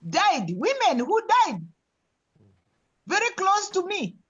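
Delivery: grieving, pitch climbing up; emotional, voice rising high. Real, not acted. Women who died close to her.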